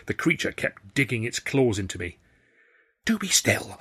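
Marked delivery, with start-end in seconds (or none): 3.0-3.8 hissed. A narrator's reading. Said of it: "Tilly hissed".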